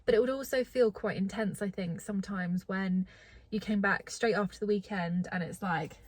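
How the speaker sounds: ordinary delivery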